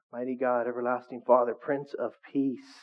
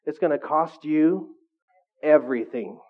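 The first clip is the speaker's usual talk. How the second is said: slow, loud, landing on "everything"; emphatic build; 4 dB louder, slower than usual. Real, not acted. Preacher warns congregation, slow emphatic stress on "everything". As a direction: pause before "everything" and hit it hard. Preacher drives home the point.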